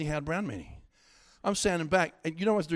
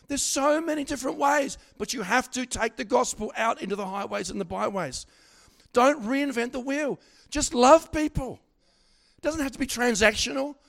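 high, slowed preacher's cry; full-force preaching; 6 semitones higher, 5 dB louder than usual. Acted. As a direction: preach it out to the room, lifting each line. Preaching climax to congregation.

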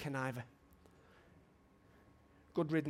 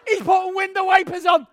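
high, loud laughing jab; laughing shout; 20 dB louder than usual. Retold or imitated. Preacher laughing at Wes's job, loud.

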